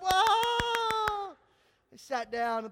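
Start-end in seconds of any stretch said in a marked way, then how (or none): 0.0-1.4 high loud cheering whoa; crowd whoa. Retold or imitated. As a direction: roar like a crowd standing.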